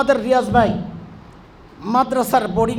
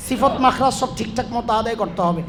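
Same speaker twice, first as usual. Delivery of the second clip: usual speaking voice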